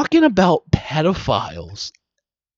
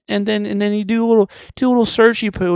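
playful cutesy; 5 semitones higher, 5 dB louder, much faster than usual. Acted.

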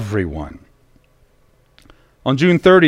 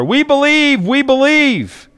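high, hammed-up crowd holler; mock holler; 9 semitones higher than usual. Preacher mocking Blondin's crowd hollering, high. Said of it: "join Blondin's crowd and holler".

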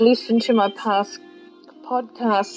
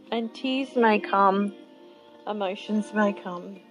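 guided meditation. Acted. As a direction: gently lead them inward. Leads a guided visualization.

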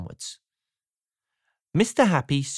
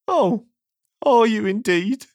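high, squeaky, sniffy character voice; sniffy, miserable; 5 semitones higher, 3 dB louder than usual. A narrator's reading. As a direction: sniff it back, sad and unimpressed. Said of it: "sniffed the person who looked like Mister Happy".

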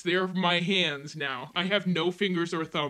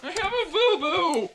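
put-on much higher babyish whine; hammed-up baby talk; 12 semitones higher, 3 dB louder than usual. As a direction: talk like a hurt toddler. Injured, babyish.

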